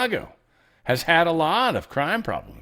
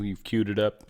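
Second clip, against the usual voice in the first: usual speaking voice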